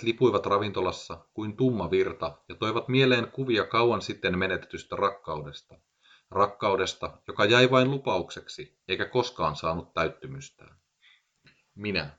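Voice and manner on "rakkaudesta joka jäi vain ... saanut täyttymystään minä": ordinary delivery, nothing marked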